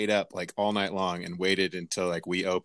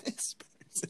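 whispered, laughing swap of the word; whispered correction; 14 dB quieter, slower than usual. Acted. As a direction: slip the polite word in under your breath.

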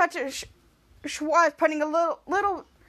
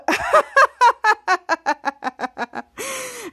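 high hammed-up laugh; loud laugh; 7 semitones higher, 6 dB louder than usual. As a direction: cackle at the joke. Character laughs.

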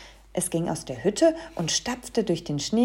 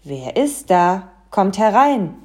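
Santa behind door; 9 dB louder than usual. A narrator's reading. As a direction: call loudly through the door. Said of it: "eine Stimme fragte".

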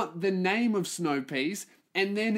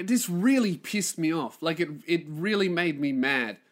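ordinary delivery

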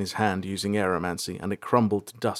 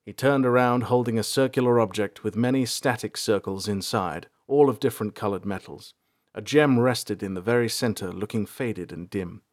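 plain reading voice

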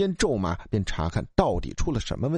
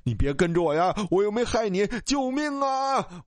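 frightened shouting; 7 semitones higher than usual. Acted. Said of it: "我也大声地喊着".

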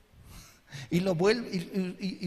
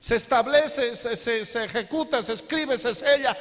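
louder and louder, a sermon crescendo; building preacher's proclamation; 4 semitones higher, 4 dB louder than usual. Acted. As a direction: stack each phrase to build suspense. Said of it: "preacher builds to a climax".

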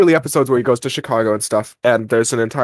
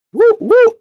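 mock cheer; 5 dB louder than usual.

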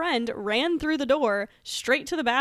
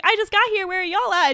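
higher, louder eager question; excited call out; 10 semitones higher, 8 dB louder than usual. Retold or imitated. Friend quoting her texted arrival.

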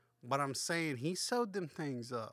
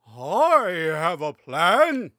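slow, booming and much louder, a wise owl announcing; slow booming owl pronouncement; grand owl voice; 3 semitones higher, 15 dB louder, much slower than usual. Acted. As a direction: drag out each word like a mighty owl proclaiming. Owl's line read dramatically.

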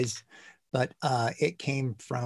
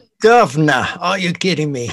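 loud, raised hammed-up Cockney; mock Cockney accent; 3 semitones higher, 9 dB louder than usual. American guest teasing the British host, mock Cockney. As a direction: tease with a stage-Cockney accent. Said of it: "That's what Americans think you talk like".

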